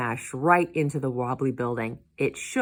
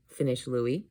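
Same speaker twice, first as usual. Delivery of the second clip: ordinary delivery, nothing marked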